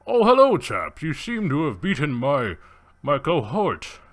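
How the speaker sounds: theatrical gentleman villain sizing up a challenger; Safari. Acted. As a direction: play a grandly condescending villainous hunter. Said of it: "you see Safari, and he's like".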